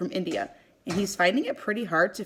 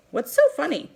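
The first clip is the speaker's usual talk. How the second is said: high, louder puzzled question; 8 semitones higher, 4 dB louder than usual. A narrator's reading. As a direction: ask it genuinely baffled. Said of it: "I wonder".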